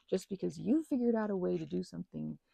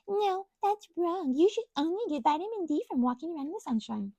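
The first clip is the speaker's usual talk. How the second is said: mock scold; 8 semitones higher, 4 dB louder than usual. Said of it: "anybody saying".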